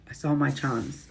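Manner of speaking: ordinary delivery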